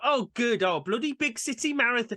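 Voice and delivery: high mock-Yorkshire grumble; northern English accent. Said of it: "They've got a northern accent".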